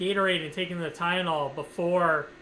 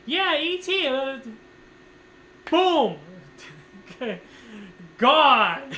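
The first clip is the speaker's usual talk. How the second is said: mock ride excitement; 6 semitones higher, 4 dB louder, much slower than usual. Joking about passing out.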